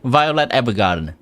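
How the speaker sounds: ordinary delivery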